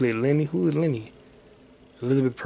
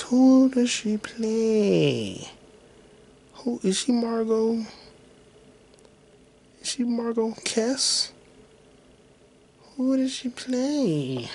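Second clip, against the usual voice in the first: drawn-out asking; 10 semitones higher, much slower than usual. Real, not acted. Drawn-out puzzled repeated question.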